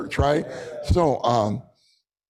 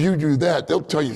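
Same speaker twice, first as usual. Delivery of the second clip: ordinary talk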